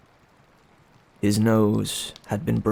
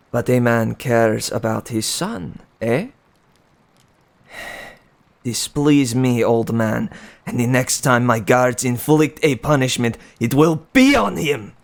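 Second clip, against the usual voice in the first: threatening king; 2 semitones higher, 4 dB louder than usual. Acted. The king threatens to punish the son, building louder. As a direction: menace building to a cold threat.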